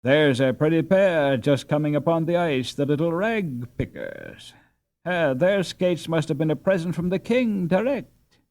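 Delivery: mocking jibe. A narrator's reading. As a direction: make fun of the poor pair. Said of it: "said mockingly to Hilda".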